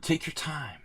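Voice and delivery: high mock-polite sarcasm; sarcastic. Sarcastic at fast opponent.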